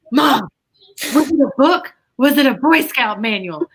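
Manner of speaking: blurting out the answer to mom; sudden realization. Retold or imitated. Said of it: "I went".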